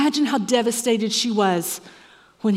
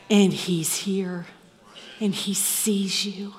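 slow hushed whisper; slower than usual. Acted. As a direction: whisper it close to the listener. Intimate altar appeal.

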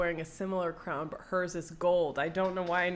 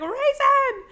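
high, loud shriek of his name; frantic shout; 11 dB louder than usual. Retold or imitated. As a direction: scream his name in panic. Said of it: "she's like".

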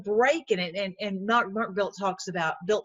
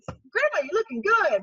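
excited greeting; 10 semitones higher, 3 dB louder than usual. Pretend. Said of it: "I'm like".

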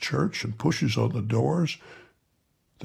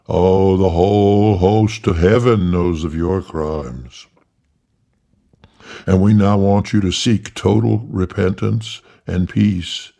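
loud, booming archangel voice; thundering archangel; 2 semitones lower, 6 dB louder than usual. Acted. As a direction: thunder it from above. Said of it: "Jock still as archangel".

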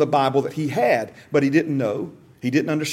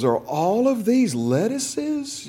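bewildered diner; 6 semitones higher, much slower than usual. Retold or imitated. Bewildered customer in a commercial.

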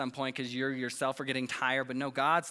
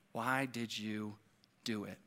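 slow, soft, low accusation; hushed, drawn-out question, like an accuser at the ear; accusing murmur; 4 semitones lower, 6 dB quieter than usual. Acted. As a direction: let the accusation sink in quietly. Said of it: "does Satan whisper that into".

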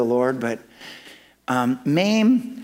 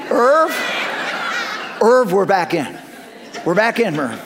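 excited laughing call; 4 semitones higher than usual. Retold or imitated. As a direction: burst out with joy at the good news.

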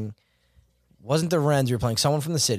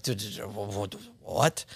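soft, low stammer of mock shock; re-enacted stunned disbelief; 3 semitones lower, 7 dB quieter than usual. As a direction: stumble over the words, dumbfounded, keeping it quiet. Re-enacting his stunned reaction.

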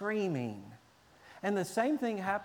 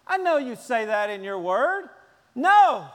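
loud, high stubborn no; mock tantrum; defiant refusal; 6 semitones higher, 9 dB louder than usual. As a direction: refuse God like a defiant kid. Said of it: "looking at God and going".

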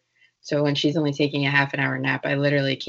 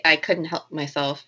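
usual speaking voice

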